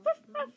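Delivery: high, loud imitated barking; dog bark. A narrator's reading. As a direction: yap like a dog.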